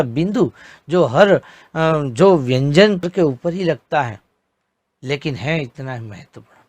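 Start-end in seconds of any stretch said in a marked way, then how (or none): none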